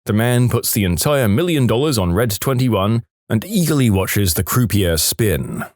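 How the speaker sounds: ordinary delivery, nothing marked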